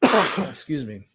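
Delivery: ordinary delivery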